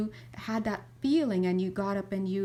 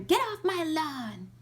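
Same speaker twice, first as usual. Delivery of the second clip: put-on higher, slow, cranky old-timer bark; grumpy old man; 8 semitones higher, slower than usual. Bitter old man yelling at kids from his door, slow cranky bark. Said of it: "Now you're in".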